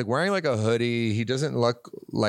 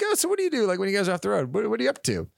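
bright, much higher mock-polite chirp; higher, chirpy imitation of himself making small talk; 9 semitones higher, faster than usual. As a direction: play the eager small-talker. Chatting politely with Fred.

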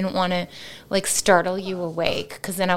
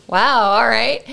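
loud, stung sarcastic wow; offended wow; 6 dB louder, slower than usual. Retold or imitated. Wife brushed off at goodnight, stung sarcastic wow. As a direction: sound insulted and brush it off. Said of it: "And I was like".

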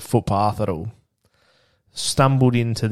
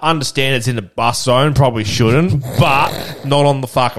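higher, louder mocking comeback; heated retort; 5 semitones higher, 7 dB louder than usual. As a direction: tell the old man off, half laughing. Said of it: "I was like".

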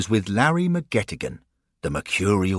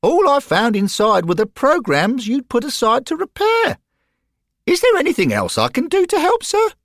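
put-on high, bright young boy voice; eager schoolboy piping up; high treble of a keen boy; boy's voice; 7 dB louder than usual. Narrator voices young Derek.

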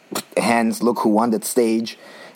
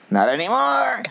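much higher-pitched, playful retort; high interjection; 13 semitones higher than usual. Real, not acted. Host cutting into talk of a sponsor, high interjection.

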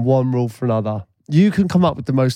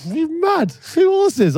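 much higher, squeaky mock-disbelief voice of the pub mates; mates' disbelief; 14 semitones higher, slower than usual. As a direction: pitch it way up like a mate who can't believe it. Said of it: "They'd go".

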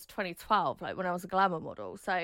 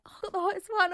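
excited squeal; 11 semitones higher, 4 dB louder, much faster than usual. Retold or imitated. Gleeful retold reaction.

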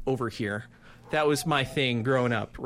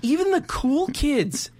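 mock heartbreak; 11 semitones higher, 4 dB louder, slower than usual. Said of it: "my heart broke for him".

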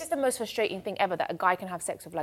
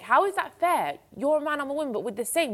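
indignant; 7 semitones higher, 4 dB louder than usual. Real, not acted. Indignant rant.

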